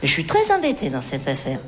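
low, measured, serious tone; grave confrontation. Acted. Manager warning Michel of a police inquiry, grave and low. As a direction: lay it out heavily, as in an interrogation.

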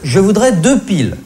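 politesse appuyée, ton plus haut; demande polie. Acted. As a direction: interpeller poliment la vendeuse, un peu haut perché. Client qui s'adresse à la vendeuse. (demande polie et appuyée)

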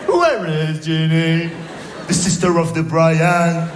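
very slow, exaggerated French-accented English; heavy French accent, slow textbook question; school English accent. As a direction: drill it like a classroom tape. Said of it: "Avec l'accent".

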